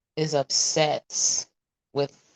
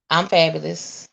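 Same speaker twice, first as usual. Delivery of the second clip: normal talking voice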